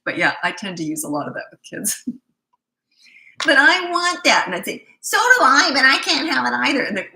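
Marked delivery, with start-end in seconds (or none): 3.4-4.4 put-on high, loud, slow childish whine; drawn-out mock child whine; whiny child. Child whining in retold exchange. 5.0-6.8 high, loud teasing whine; playful copycat whine, much higher; mock whine back. Parent mock-whines back at child.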